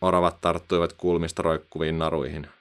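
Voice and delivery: plain reading voice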